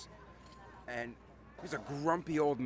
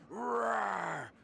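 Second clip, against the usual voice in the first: loud, high imitated tiger roar; 5 semitones higher, 5 dB louder than usual. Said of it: "when I went roar".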